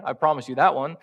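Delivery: usual speaking voice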